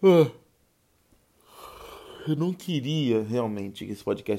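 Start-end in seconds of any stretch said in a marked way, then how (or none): 0.0-0.3 yawn. Real, not acted. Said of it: "querendo bocejar de novo".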